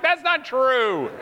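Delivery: high, drawn-out comic objection; mock protest. Husband disputing his wife's reply, mock exasperated. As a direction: stretch it out in exasperation.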